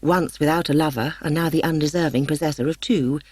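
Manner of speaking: ordinary delivery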